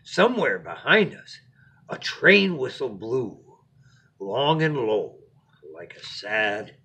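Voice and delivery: lively storybook narration; expressive read-aloud to a little one; bedtime story reading. A narrator's reading.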